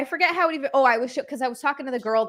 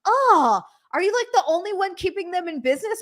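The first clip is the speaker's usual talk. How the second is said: higher-pitched, incredulous retold question; mock incredulous; 7 semitones higher than usual. Gym friend questioning a candy corn lover, mock incredulous. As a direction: act out an amazed friend's reaction.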